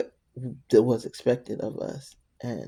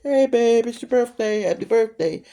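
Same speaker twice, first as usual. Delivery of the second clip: high, louder, sugary birthday greeting; mock sweet greeting; 9 semitones higher, 5 dB louder than usual. Dad's imagined happy birthday to his son, in a mock sweet high voice. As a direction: put on a cheery, sweet dad voice, much higher. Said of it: "he wasn't gonna say".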